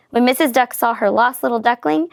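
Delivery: ordinary delivery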